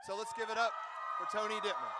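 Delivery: loud, rising hype introduction; hype announcement. Real, not acted. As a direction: pump the crowd up for the next speaker. Host hypes crowd for speaker.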